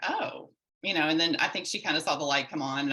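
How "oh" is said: dawning oh. Retold or imitated. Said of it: "I was kinda like".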